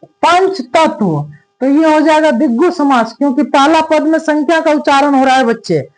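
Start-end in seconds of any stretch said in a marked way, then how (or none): 0.1-1.2 पंच तत्व stretched very slowly, पंच stressed; very slow spelling out. Shown as a demonstration. Teacher slowly repeating the example so students catch it. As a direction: drag out पंच then तत्व. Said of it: "teacher repeating compound".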